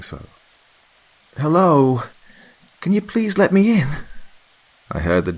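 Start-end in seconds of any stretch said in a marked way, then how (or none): 1.4-4.0 much higher, louder call through the door; an impatient friend locked outside; Mary calling out. A narrator's reading.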